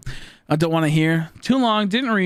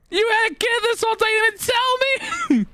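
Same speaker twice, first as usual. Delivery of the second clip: stunned outrage. Retold or imitated. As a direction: drag it out in high-pitched shock. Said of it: "I'm like".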